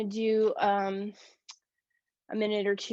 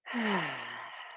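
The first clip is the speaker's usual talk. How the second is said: softer, falling audible exhale; open-mouth sigh; 6 semitones lower, 8 dB quieter than usual. Shown as a demonstration. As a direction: gently sigh the breath out. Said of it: "Sigh it out".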